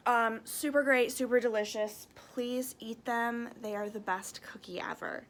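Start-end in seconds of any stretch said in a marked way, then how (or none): 2.1-5.3 whisper. Acted. Teacher whispering a cookie secret to her viewers. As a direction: let it drop to a secret whisper.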